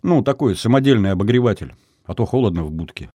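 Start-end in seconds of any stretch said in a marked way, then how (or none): none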